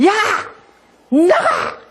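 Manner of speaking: loud, high imitated shout; furious creditor. Enraged creditor to the debtor, acted angry shout to get out. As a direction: yell it as an enraged creditor throwing someone out. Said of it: "박사님 너무 화가 나가지고요".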